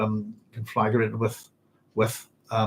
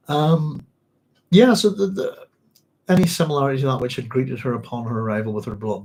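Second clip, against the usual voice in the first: ordinary talk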